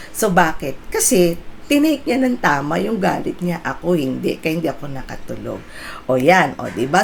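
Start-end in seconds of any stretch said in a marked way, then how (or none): none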